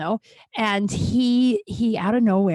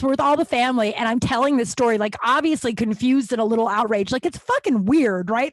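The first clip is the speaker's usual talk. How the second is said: normal talking voice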